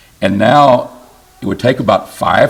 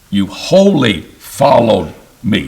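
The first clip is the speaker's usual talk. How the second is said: wholly drawn out slowly and evenly; slow, deliberate scripture quote with "wholly" stressed; slower than usual. Shown as a demonstration. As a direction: lean on "wholly" and say it slowly, meaning totally. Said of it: "Not holy like sanctification, but totally".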